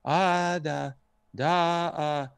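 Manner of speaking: imitated baby babble, drawn-out syllables; infant-like babbling. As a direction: babble like an infant's first sounds. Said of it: "this crazy babble".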